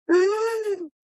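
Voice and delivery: sharp, high mock gasp of alarm; shocked gasp. Planner hearing the bride quit mid-rehearsal, loud gasp. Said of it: "And I was like".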